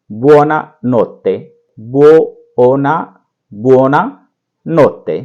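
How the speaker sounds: buonanotte stretched out slowly; repeat-after-me drill; slow model word. Shown as a demonstration. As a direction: draw out buonanotte for students to copy. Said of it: "इसको बोलेंगे".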